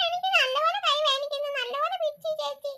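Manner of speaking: put-on high, slow child's complaint; a small child telling on his sister; whining child. Child complains of pinched hand.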